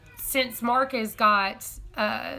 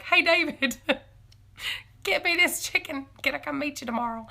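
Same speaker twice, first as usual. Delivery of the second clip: eager giggly plea; 6 semitones higher than usual. Pretend. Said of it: "Now I'm gonna be like".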